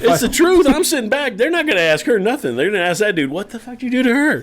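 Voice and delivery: high, worked-up comic argument; animated insisting. Real, not acted. Storyteller defends his plan to laughing friends, high animated insistence.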